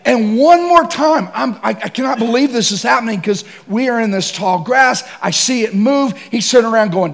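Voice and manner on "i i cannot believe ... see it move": ordinary delivery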